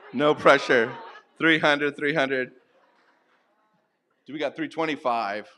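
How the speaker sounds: self-admitted novice playing auctioneer, loud and lively; auctioneer impression. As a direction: call out the prices like an amateur auctioneer at a charity sale. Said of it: "my best impression of an auctioneer".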